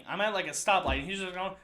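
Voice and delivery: ordinary delivery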